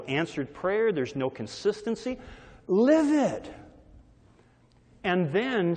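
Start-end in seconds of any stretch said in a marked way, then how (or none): 2.7-3.5 high emphatic cry; a sudden high shout. Real, not acted. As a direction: burst out with it. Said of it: "preacher punches the key word".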